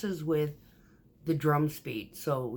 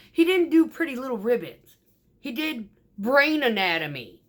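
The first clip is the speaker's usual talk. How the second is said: punchline retort; 7 semitones higher, 7 dB louder than usual. Retold or imitated. Punchline told to the office.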